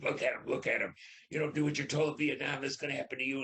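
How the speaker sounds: high, fast, angry drill-sergeant shout; retold furious yell. Sergeant shakes him at the body.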